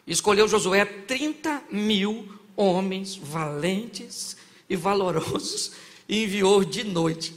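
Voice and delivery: slow, measured Bible reading; scripture reading. Acted. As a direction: read it out slowly and gravely.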